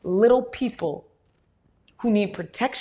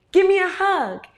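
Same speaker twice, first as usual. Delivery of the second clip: 孩子的拥抱请求; 9 semitones higher, 4 dB louder than usual. Retold or imitated. Adult coaxing a three-year-old.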